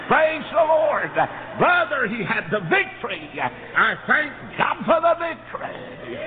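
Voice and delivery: high, loud, drawn-out praise cry; preaching at full force. Acted. Preacher crying out praise to the congregation, high and loud. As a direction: stretch it out in a shouted praise.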